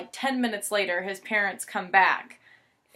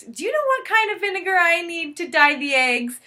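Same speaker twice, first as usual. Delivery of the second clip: imitating mom; 8 semitones higher, 4 dB louder than usual. Mom's fake question, retold.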